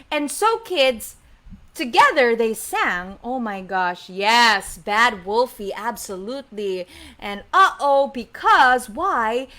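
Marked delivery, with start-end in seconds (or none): none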